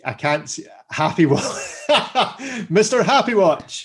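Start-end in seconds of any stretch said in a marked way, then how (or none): 1.9-3.8 amused high delivery; laughing. Real, not acted. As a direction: say it through a giggle. Laughing at the watch name.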